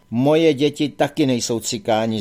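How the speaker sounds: ordinary delivery, nothing marked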